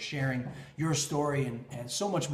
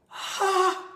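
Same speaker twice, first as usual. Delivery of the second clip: short high bright burst; one delighted exclamation; joyful ha; 4 dB louder than usual. Real, not acted. Husband describing wife's joy, joyful ha.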